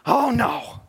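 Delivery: high, loud exaggerated "oh no"; mock kids' dismay. Preacher imitating his kids balking at a hindrance, mock dismay. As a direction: whine it like a dismayed kid, hammed up. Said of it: "be like my kids".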